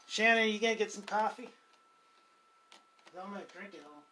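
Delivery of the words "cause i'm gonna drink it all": softer, sly aside; soft tease. Real, not acted. Teasing Shannon about the coffee, soft sly aside. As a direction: mutter the tease half to yourself.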